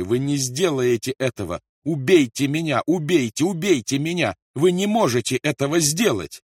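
loud, high, despairing pleading scream; desperate scream. A narrator's reading. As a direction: scream at him, begging to die instead. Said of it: "закричала Кэт и забилась на стуле". (отчаянный крик матери)

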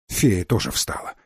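ordinary delivery, nothing marked

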